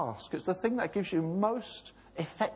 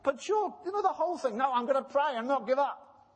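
raised challenging voice; 7 semitones higher, faster than usual. Acted. Acted dialogue with Elijah.

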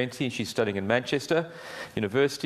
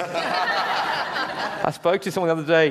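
laughing; 7 semitones higher, 5 dB louder, slower than usual. Real, not acted. Chuckling after shared joke.

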